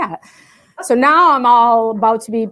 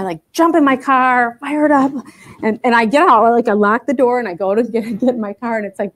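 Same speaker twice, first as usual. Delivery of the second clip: ordinary talk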